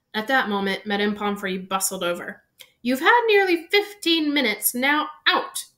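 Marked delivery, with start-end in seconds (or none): 2.8-5.6 high, loud matron giving orders; raised, insistent voice; firm. A narrator's reading. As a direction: shoo the visitors out with firm authority. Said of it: "she said firmly".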